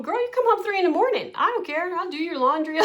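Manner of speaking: put-on high, fast, fond mom calling her kid home; a mother telling her grown kid she's always welcome home; doting mom voice. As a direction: gush like an easygoing mom who doesn't mind late nights. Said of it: "they call me".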